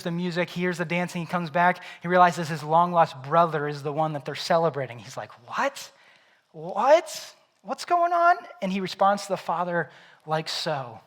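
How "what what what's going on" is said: much higher, louder, incredulous what; shocked older son. Acted. Older son hearing music for his lost brother, stunned. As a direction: shoot up in disbelief.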